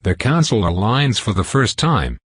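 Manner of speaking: plain reading voice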